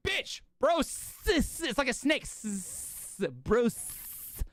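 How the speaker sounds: high-pitched exasperated correction, hissing the S; exasperated explaining. Retold or imitated. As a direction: stretch out the S like a snake. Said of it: "I'm like".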